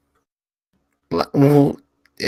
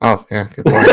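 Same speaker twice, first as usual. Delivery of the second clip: usual speaking voice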